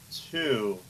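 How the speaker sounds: ordinary talk, nothing marked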